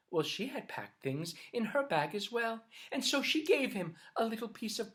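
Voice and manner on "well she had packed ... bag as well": plain reading voice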